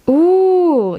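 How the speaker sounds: high, loud gushing ooh; bright impressed ooh; delighted ooh. Acted. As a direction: squeal it with delight. Reading out a flattering message.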